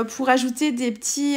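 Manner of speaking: normal talking voice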